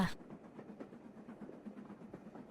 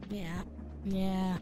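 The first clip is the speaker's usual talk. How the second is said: normal talking voice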